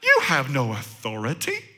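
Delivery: accusing devil. Acted. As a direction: deny him with quiet, low scorn. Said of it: "the devil is saying".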